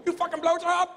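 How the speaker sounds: put-on high, fast Vietnamese-accented cussing. Vietnamese guy cussing out the comedian, high accented rant. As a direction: spit it out like an angry shop guy. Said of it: "There's nothing you can say".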